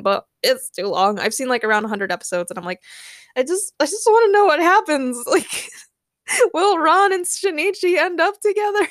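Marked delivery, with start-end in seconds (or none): 3.3-5.2 high, pleading mock desperation; desperate fan. Acts out frustrated fan. 6.5-8.9 high, playful mock desperation; desperate fan. Acts out impatient fan.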